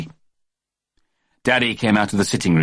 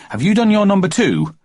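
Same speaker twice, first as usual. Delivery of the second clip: high mock-stern mother; a child parroting his mum; mimicking Mummy; 12 semitones higher, 3 dB louder than usual. Jeremy James mimicking Mummy's nagging, high mock-stern voice.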